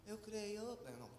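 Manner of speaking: normal talking voice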